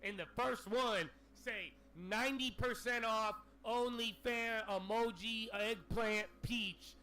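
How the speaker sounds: retold rant. Streamer replaying his outburst to viewers, loud and indignant. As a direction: rant it loudly to the chat. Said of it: "I said".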